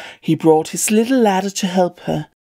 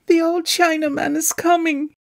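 higher, frightened cry; 7 semitones higher than usual. A narrator's reading. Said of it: "cried the little shepherdess in a fright".

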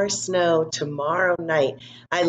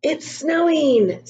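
put-on higher, drawn-out happy child voice; excited little boy; 9 semitones higher, 4 dB louder, much slower than usual. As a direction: sound like a kid thrilled by the first flake.

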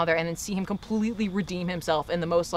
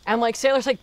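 usual speaking voice

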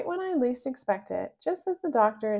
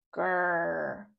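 dog growl; 4 semitones lower than usual. Acted.

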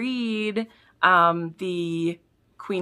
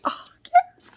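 squeal; 5 dB louder than usual. Real, not acted. Squeal at friend's note.

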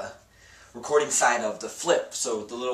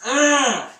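appreciative hum; 13 semitones higher, 9 dB louder than usual. Acted. Admiring himself in uniform.

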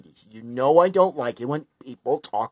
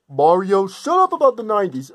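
loud and higher, snapping at Mario; angry yell; 5 semitones higher, 6 dB louder than usual. Acted. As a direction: yell at him to stop talking. Sonic snaps at Mario.

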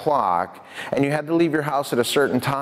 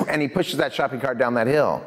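usual speaking voice

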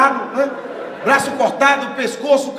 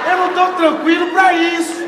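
loud and higher, panicking; mock panicked shout; 5 semitones higher, 6 dB louder than usual. Comic tells the crowd he was not calm, loud panic. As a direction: yell it like you're trapped.

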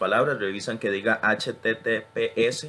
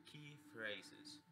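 quiet repeat; 27 dB quieter than usual. Shown as a demonstration. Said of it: "la escuchan y la repiten".

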